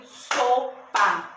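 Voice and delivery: so, pa as two separate claps' beats; split into syllables. Shown as a demonstration. Teacher clapping out sopa for pupils, syllable by syllable. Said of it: "Se eu for bater palma".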